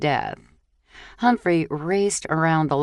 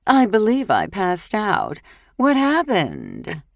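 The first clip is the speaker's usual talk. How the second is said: loud as could be; 6 semitones higher, 4 dB louder than usual. Acted. Natasha covering her kiss with Humphrey, loud and high. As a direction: announce it loudly for the whole table. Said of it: "loud as could be".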